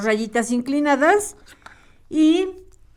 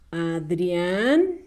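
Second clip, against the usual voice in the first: "Adrián" alargado, con la última A subiendo; acento en la última A; 3 semitones lower than usual. Shown as a demonstration. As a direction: carga la voz en el final de "Adrián". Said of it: "con acento en la última A". ("Adrián" con acento final, dictado despacio)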